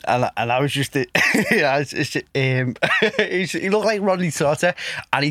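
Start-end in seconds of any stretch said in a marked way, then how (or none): 3.0-4.7 laughing. Real, not acted. Laughs recalling his teacher.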